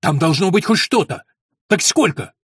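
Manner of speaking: loud, angry demand; boiling over. A narrator's reading. Young lord demanding money from his steward, boiling over. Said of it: "вскипел Уильям".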